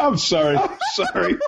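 squeaky giggling sorry; apologizing through a laughing fit; laughing apology. Real, not acted. Player cracking up at the game master, laughing.

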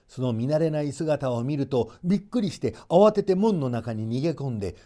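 ordinary delivery, nothing marked

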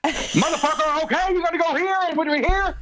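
high, far-off bossy crowd-wrangler voice; ringleader voice. Retold or imitated. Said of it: "big fucking ringleader voice".

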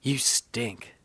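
breathy whispered "You stink"; a cold whisper right in his face; whispered put-down. Retold or imitated. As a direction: lean in and whisper the insult. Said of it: "he just goes".

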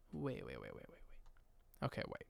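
urgent whispered repeats. Acted. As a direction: hush it like a sudden realization. Panicked realization aside.

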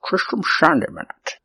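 put-on lazy, soft Scottish accent; half-accidental, lazy Scottish film-star accent; Sean Connery. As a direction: slur it in a loose Scottish accent. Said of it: "lazy Sean Connery".

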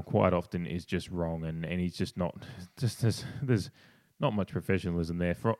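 ordinary talk

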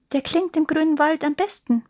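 high bird voice, unforced; reply modestly as a small, high-voiced bird; little nightingale. A narrator's reading. Nightingale replies in the story. (hohe Stimme einer kleinen Nachtigall)

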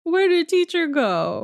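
high, plaintive mock kid voice; hammed-up child asking for teacher; childlike whine. Echoes kids' line in high voice.

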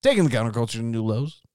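usual speaking voice

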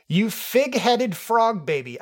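high, slow, sneering read; snooty director voice. Acted. Reading the director's angry review, high and sneering. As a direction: sneer out the insult slowly.

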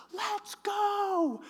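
very high, loud put-on woman's yell; woman calling out. Woman runs in calling her partner away, high urgent shout. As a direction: mimic a woman hollering from across the street.

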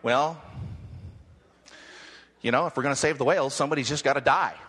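low, fast mock old man; old dude on Whale Wars. Preacher mimics the old whale warrior, lightly voiced. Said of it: "he's going".

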